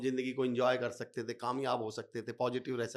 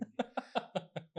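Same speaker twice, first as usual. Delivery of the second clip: laugh; 10 semitones higher, 6 dB quieter than usual. Real, not acted. Laughs at inspector example.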